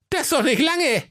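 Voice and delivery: very high, squeaky reproach; reproachful dwarf. Acted. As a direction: protest it as an offended little dwarf. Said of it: "sagte der Hauke vorwurfsvoll".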